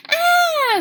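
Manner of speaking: very high, loud shriek; scream. Acted. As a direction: shriek in alarm. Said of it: "screamed the girls again".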